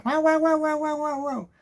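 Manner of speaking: high yapping barks from a tiny dog; yappy dog barks. Retold or imitated. Imitating the neighbor's tiny dog barking nonstop, high yapping. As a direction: yap like a scared little dog.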